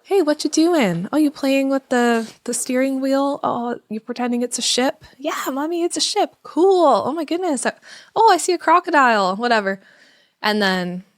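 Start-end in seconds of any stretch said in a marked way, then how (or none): 0.0-9.4 put-on higher, playful make-believe with the child; playful mom voice. Mom playing along with her daughter at the playground. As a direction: join the pretend game with delight. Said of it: "when I would start".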